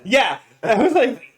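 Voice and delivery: breaking into a laugh mid-sentence. Real, not acted. Laughs at the NPC line.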